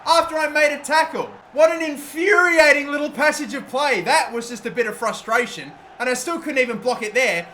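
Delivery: loud, high-pitched furious rant; exasperated outburst at own defending; real frustration. Real, not acted. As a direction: vent the anger at the screen. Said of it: "Now I'm getting mad".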